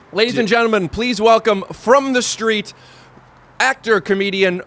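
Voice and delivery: slow stage announcer intro. Acted. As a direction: introduce him like a club emcee. Introduces comedian to imagined crowd.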